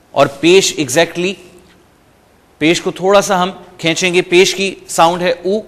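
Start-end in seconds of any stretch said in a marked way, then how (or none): none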